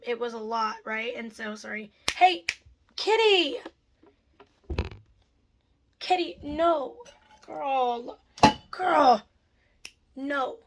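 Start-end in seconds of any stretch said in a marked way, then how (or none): 2.1-3.6 high, loud call to the cat; sharp high call across the room. Real, not acted. Owner calling out loudly to her cat. 6.0-10.6 high, firm no to the cat; scolding the cat. Real, not acted. Owner telling her cat to stop, firm scolding.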